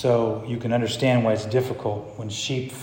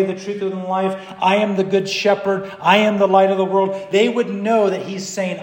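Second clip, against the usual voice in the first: higher, insistent pulpit argument; forceful preaching; 7 semitones higher, 4 dB louder than usual. Real, not acted. Preacher argues to church that Jesus said he was God, insistent. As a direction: build the case claim upon claim.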